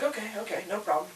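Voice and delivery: high-pitched imitation of a caller; lightly mocked, high easy agreement; agreeable customer. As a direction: mimic a placating customer, voice lifted high.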